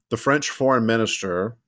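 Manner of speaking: ordinary delivery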